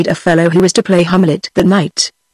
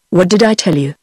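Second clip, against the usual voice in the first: ordinary delivery, nothing marked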